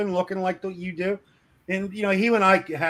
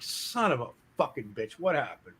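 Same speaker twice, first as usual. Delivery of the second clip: annoyed mutter; 3 semitones lower, 7 dB quieter than usual. Retold or imitated.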